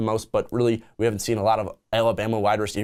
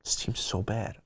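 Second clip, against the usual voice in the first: hushed aside under the breath; whispering; 10 dB quieter, slower than usual. Real, not acted. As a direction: whisper it to yourself in disgust. Muttering to himself before intro.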